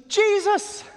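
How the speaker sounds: very high and loud cry; joyful recognition. Acted. Mary recognizing Jesus, joyful high cry. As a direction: cry out in sudden joy.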